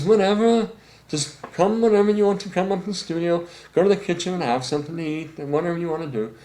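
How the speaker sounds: high, loud, breezy George impression; tossing it off like a laid-back rock star; imitating George. George answering his sax player, high and breezy.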